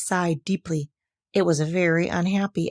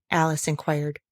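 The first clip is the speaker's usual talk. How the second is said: plain reading voice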